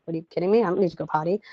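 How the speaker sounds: a grumbling little one who won't budge; a hint of a sulky child; mock whiny kid.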